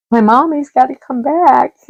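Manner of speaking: high, whiny little-dog voice; puppy's voice. Retold or imitated. Puppy crying at the door for his owner, high whiny pretend voice.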